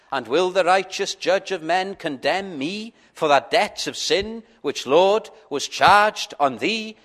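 put-on measured reading of an old hymn verse; reciting a hymn to the congregation; hymn recitation. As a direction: recite the verse with reverent weight. Reads hymn verse aloud.

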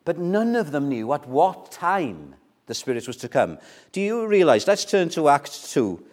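usual speaking voice

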